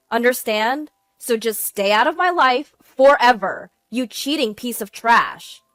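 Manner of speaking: loud, forceful threats; furious. A narrator's reading. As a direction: cut him off and vow revenge. Wife's final furious threat.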